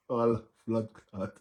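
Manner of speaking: usual speaking voice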